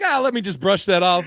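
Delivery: put-on high, loud offhand kid; lightly voiced carefree kid; careless kid voice.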